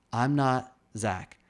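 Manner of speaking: slow, with weight on "not"; emphatic. Real, not acted. Admitting to himself he's unlike Zach, slow stress on "not". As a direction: lean on "not" in "I'm not Zach" slowly.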